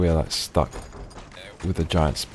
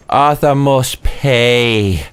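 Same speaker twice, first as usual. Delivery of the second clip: slow, drawn-out, higher movie-villain vow; dramatic vengeance vow; 6 semitones higher, 4 dB louder, much slower than usual. Pretend. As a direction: stretch it out like a grim revenge oath. Catchphrase with his name.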